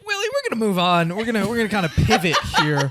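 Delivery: smooth talk-show host voice; newscaster voice. Acted. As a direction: read it like a morning TV host. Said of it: "Don't put on your newscaster voice".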